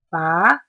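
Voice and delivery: "ba" rising from middle to top; rising second tone. Shown as a demonstration. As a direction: lift "ba" steadily upward. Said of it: "starts in the middle and rises to the top".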